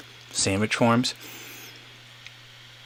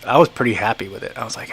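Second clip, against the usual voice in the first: ordinary talk, nothing marked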